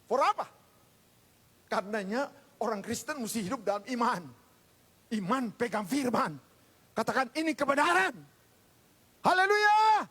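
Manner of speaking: rousing pulpit proclamation; full-force preaching. Real, not acted. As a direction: drive it up to a shouted hallelujah.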